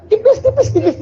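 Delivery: laughing. Real, not acted. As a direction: laugh the words out. Joking about thin envelope. (sambil tertawa, nada tinggi)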